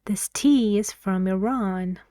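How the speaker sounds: "tea" and "Iran" stressed, other words light. Shown as a demonstration. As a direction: lean on "tea" and the end of "Iran". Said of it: "the words are tea and Iran".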